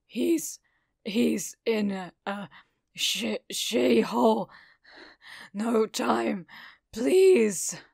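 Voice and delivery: rough, stammering, badly injured plea; struggling to speak. A narrator's reading. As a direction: strain out broken words, urgent and pleading. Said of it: "he struggled, coughing again".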